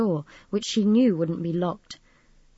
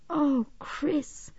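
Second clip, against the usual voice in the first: high, hushed dismay; barely find the words; small, stricken voice; devastated; 8 semitones higher, 4 dB quieter than usual. A narrator's reading. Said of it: "so devastating that she was almost lost for words".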